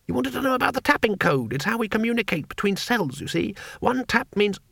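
high, eager little man; chirpy high-pitched foreigner; high tourist voice. A narrator's reading. Two Flower voiced by narrator.

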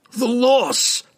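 very high wail of grief; anguished wail. A narrator's reading. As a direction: let the grief peak in a piercing cry. Delirious dwarf relives the disaster.

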